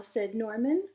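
lively storybook read-aloud voice; reading to children. A narrator's reading. Teacher reading a storybook aloud to her class, lively. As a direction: read it bright and animated for little listeners. Reads picture book to children.

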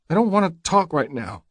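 high-pitched, upset woman's voice; a touch of tearfulness, pitched high; near tears. A narrator's reading. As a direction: refuse in a high voice, close to crying. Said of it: "a husky note in her throat, as if she is about to start crying again".

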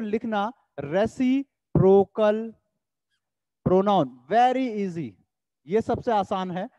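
drawn-out, careful classroom dictation; slow dictation. Shown as a demonstration. Teacher dictates the term to students, very slow. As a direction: spell the term out very slowly for notes. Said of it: "dictating term slowly".

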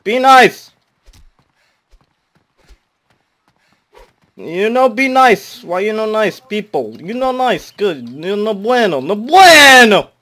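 loud, high broken-English scolding; scolding villagers in broken English; angry scolding. Acted. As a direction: yell at them to be nice.

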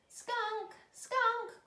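high, loud call for a lost pet. Acted. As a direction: call out as if searching. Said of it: "called Izzy".

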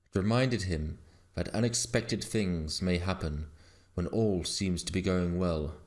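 ordinary delivery, nothing marked

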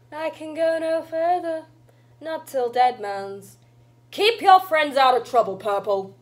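put-on old witch. Granny parting from Purple, commanding old witch. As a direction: warn them as you part. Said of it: "an old witch".

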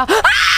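high cry of fright; startled scream. Real, not acted. Streamer calling for silence then scared, startled scream. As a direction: scream at a sudden shock. Jump scare while playing.

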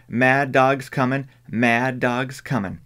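urgent slow warning shout. Acted. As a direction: cry the warning out slowly. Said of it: "and she shouted".

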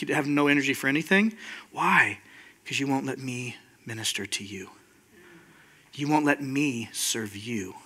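low, hushed, tender confrontation; gentle Jesus. Acted. Jesus telling a worn-out servant to accept care, gentle.

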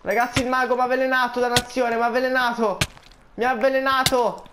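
voce acuta e forte, in preda al panico. Real, not acted. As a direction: grida aiuto mentre perde vita. Avvelenato dal mago, chiede aiuto. (grida in panico, avvelenato dal mago)

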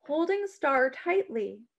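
usual speaking voice

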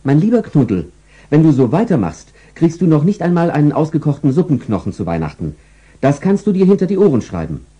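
stern mistress lecturing her pet; scolding owner. Acted. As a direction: wag a finger at the dog. Frauchen scolds the dog.

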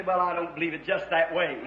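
low, soft mock of a grumbling doubter; mimicked doubter. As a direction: imitate a dismissive objector, low and soft. Mimics doubters walking away, then scorns them.

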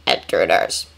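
flat, lifeless robot voice; dull droid voice. Acted. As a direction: say it dull and mechanical. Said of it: "said a dull voice from the entrance".

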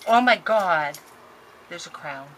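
normal talking voice

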